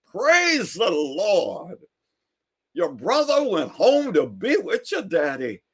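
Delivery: high, slow praising shout; shouting preacher. Retold or imitated. Neighbor rejoices on his porch, high slow shouting praise.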